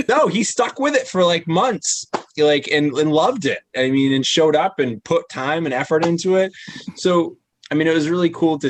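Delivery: excited. Real, not acted. Excited about the biker sticking with it.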